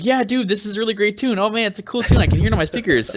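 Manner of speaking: high, gushing fake praise; gushing fans. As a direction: mimic the overexcited commenters. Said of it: "everyone posted".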